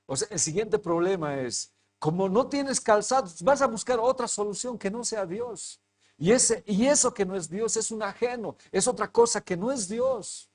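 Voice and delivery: sermón ferviente, alto y fuerte; predicación a plena voz. Acted. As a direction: arenga a la congregación con fervor. Predica con fuerza. (predicación enérgica y alta)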